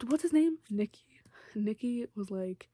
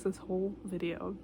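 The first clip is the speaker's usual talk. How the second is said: ordinary talk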